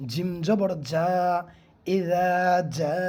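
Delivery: very slow, drawn-out জিম জবর যা; hard jim recited. Shown as a demonstration. Teacher recites the example word for students. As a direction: recite ইজা slowly with a firm j. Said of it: "জিমটা কীভাবে পড়তে হবে".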